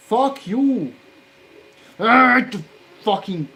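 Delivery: screamed high and loud in real rage; a sudden loud outburst of fury; furious shout. Real, not acted. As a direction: blow up at the circuit board. Raging at a soldering job.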